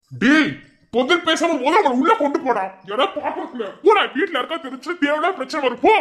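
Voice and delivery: high, agitated scolding; angry urgent orders. Acted. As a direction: order him about in a panic. Angry orders in acted emergency.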